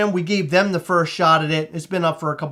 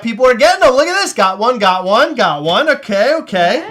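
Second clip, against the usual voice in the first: excited; 5 semitones higher, 7 dB louder than usual. Real, not acted. Watching sales come in live.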